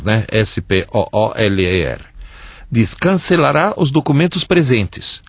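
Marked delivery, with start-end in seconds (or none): 0.3-2.0 letter by letter: S P O O L E R; spelling it out. Shown as a demonstration. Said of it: "esse Spooler com dois Os".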